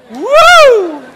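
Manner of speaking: loud, high, long "woo!" of joy; big celebratory whoop; excited whoop. Acted. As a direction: whoop out loud at sudden good news. Acts joy at surprise refund.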